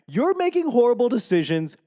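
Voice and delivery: high-pitched voice of an alarmed body; scolding body. Acted. Body reacting to rice, high-pitched mock scolding. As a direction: scold yourself from the inside. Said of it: "My body just didn't know".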